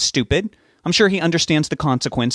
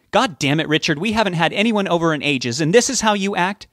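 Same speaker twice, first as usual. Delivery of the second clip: high-pitched scolding, moderately raised; put-on yelling; 8 semitones higher than usual. A narrator's reading. Betty covers for Rob before her husband, mock yelling. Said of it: "I have to start yelling now".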